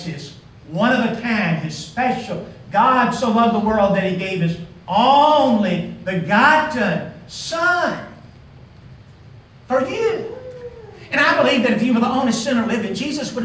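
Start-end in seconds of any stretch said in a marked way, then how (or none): 2.7-10.4 slow, stressed build to a high "son for you"; emphatic scripture. Real, not acted. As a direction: lean hard on "only begotten son". Preacher quoting John 3:16 emphatically.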